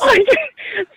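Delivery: sudden high giggle; high burst of laughter. Real, not acted. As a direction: crack up laughing, pitched high. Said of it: "I can't stop laughing".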